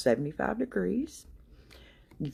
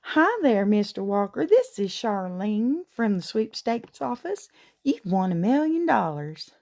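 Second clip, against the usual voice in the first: sweet Southern Belle; 5 semitones higher than usual. Retold or imitated. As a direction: play a syrupy Southern Belle selling a fake prize. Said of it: "her sweetest Southern Belle voice".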